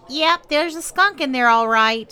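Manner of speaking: high, loud matter-of-fact woman; neighbor wife. A narrator's reading. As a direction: confirm it bluntly.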